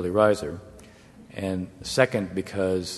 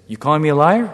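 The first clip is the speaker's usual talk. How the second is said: higher, louder accusing question; rising indignant challenge; challenging; 6 semitones higher, 7 dB louder, faster than usual. Retold or imitated. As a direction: press the accusation harder. Arafat repeating the challenge, louder.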